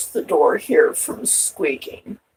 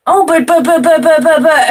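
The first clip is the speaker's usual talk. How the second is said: mock stammering; 9 semitones higher, 7 dB louder than usual. Mocking people's excuses.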